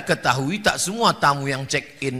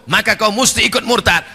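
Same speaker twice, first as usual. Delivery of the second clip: ordinary talk, nothing marked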